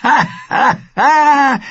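high, loud guffaw; laughing. A narrator's reading. Wildling laughs at the news of his parentage, guffawing. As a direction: laugh out in big separate bursts. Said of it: "Raghweil lachte".

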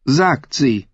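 high, cold, haughty queen; evil queen. Acted. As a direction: sneer down at him as queen. Cersei doubting Jaime.

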